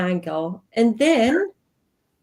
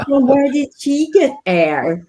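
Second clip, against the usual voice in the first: louder, higher mock-incredulous quote; imitating baffled classmates; 5 semitones higher, 5 dB louder than usual. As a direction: play the classmates' loud disbelief. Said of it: "everyone looked at me and was just like".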